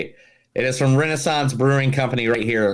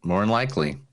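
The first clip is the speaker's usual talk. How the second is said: ordinary talk, nothing marked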